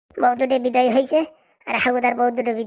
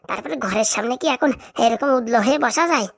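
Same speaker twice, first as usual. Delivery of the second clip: normal talking voice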